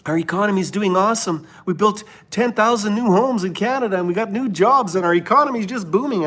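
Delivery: mock politician bragging, high and fast. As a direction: sneer at it as their lying press line. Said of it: "they look at you and they lie".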